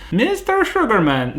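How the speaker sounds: a mock German accent, pitched high; bad German accent. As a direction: play a German psychiatrist in a hammed-up German accent. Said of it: "That was the worst German accent ever".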